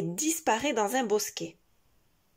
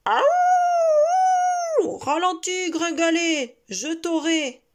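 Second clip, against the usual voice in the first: high, loud wolf howl and shout; 8 semitones higher, 8 dB louder, much slower than usual. Acted. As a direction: howl while chasing the rabbit. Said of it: "Le loup le poursuit et hurle".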